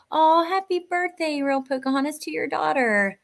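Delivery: high, sing-song congratulations; delighted birthday wish. Real, not acted. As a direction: coo a happy birthday, pitched well up. Birthday wish to viewer's daughter.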